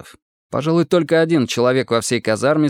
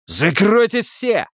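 high, drawn-out bark; barked order; 10 semitones higher, slower than usual. A narrator's reading. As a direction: bark at them to shut up. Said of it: "рявкнул Бурыба". (рявкающий окрик)

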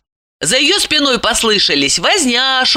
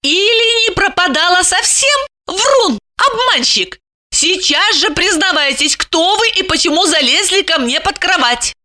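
very high, gloating woman's voice; triumphant; 11 semitones higher than usual. A narrator's reading. Lipochka catches the liar out, triumphant. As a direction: pounce on the slip, high and accusing. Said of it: "Липочка обрадовалась".

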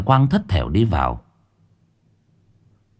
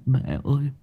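much higher, whimpering call; sobbing plea; 12 semitones higher than usual. Acted. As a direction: call out through tears. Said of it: "mếu máo lắp bắp".

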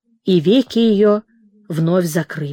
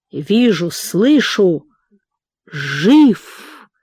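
hoarse wheeze; much slower than usual. A narrator's reading. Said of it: "хрипел шаман".

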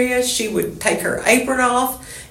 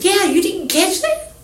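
high put-on voice, teasing question; mock reply; 12 semitones higher than usual. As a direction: tease her back in a squeaky voice. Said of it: "I said".

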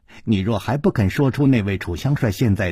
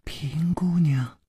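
whispered call through the door; soft call; 4 semitones higher, 5 dB quieter, much slower than usual. Acted. Servant whispering his mistress's name outside the door. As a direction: breathe her name softly from outside.

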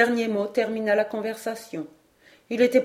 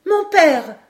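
exclamation aiguë et forte de jeune garçon; s'écrier, saisi, d'une voix haute; cri d'enfant; 9 semitones higher, 8 dB louder than usual. A narrator's reading. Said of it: "s'écria Robert Grant". (cri aigu d'enfant)

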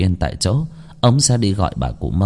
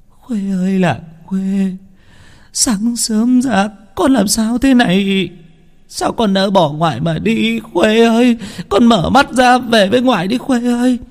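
put-on high, slow wailing old woman's lament; an old grandmother keening over her grandchild; 13 semitones higher, slower than usual. A narrator's reading. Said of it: "tiếng khóc oán than đau khổ". (bà ngoại than khóc thảm thiết)

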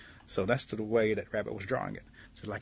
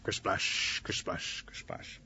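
unvoiced splashes fading away; imitated splashes; 4 semitones lower, 7 dB quieter, much slower than usual. Rabbit throws rocks at the pond, fading splash noises. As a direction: whoosh each one softer than the last.

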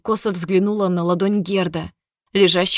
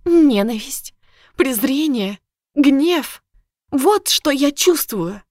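higher, louder, slow and venomous; hateful outburst; 6 semitones higher, 3 dB louder, slower than usual. Acted. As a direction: spit out each feeling. Girl spits her hatred.

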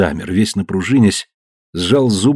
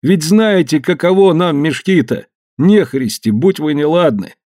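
whining lament; 7 semitones higher than usual. Acted. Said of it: "плакался Смольков".

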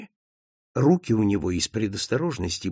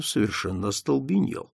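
ordinary delivery, nothing marked

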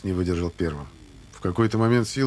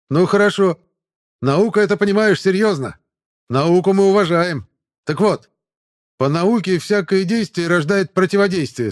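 loud, high barking orders; shouting drill sergeant; 10 semitones higher, 7 dB louder than usual. Acted. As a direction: bark push-up orders at recruits. Sergeant orders push-ups.

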